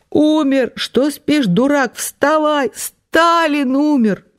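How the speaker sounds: high, wailing shrieks; wailing cry. A narrator's reading. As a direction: wail it out in panic. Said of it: "мать выла всё громче". (вопль не своим голосом)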